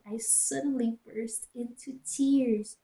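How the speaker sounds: normal talking voice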